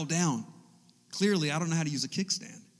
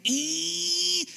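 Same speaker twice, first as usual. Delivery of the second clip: high, steady engine squeal; long high-pitched "eee" like a tiny motor; moped whine; 11 semitones higher than usual. Retold or imitated. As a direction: imitate a little moped whining.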